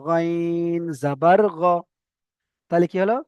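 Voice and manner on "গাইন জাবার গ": গাইন জাবার গ, very slow, গ kept short; slow short-vowel reading. Shown as a demonstration. Teacher to students, slow recitation. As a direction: spell slowly, don't stretch গ. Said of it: "টান হবে না".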